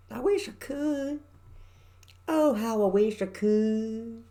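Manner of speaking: high, slow, hammed-up West Virginia twang; mock country accent. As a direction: drag it out in an exaggerated country drawl. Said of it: "You think I'm mocking the country accent".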